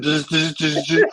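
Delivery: imitated intercom buzz, short repeated zzz; a quick mimicked buzzer; buzzing noise. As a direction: buzz like a studio call light. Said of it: "you're buzzing".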